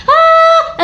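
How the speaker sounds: sharp high yell, copying someone screaming past; imitated yell. Telling her listeners about the voice in the lane, imitated sharp yell. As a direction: mimic the scream running down the lane. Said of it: "ऐसे चिल्ला के करके".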